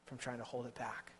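soft, low, worn-out admission; weary confession. Acted. Jeremiah confessing to God, soft and worn out. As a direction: barely get the words out, spent. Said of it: "he said".

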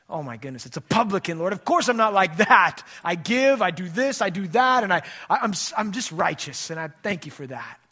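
loud self-satisfied boasting Pharisee; smug Pharisee. Acted. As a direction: puff up like a proud religious man bragging to God. Preacher mimics boastful Pharisee praying.

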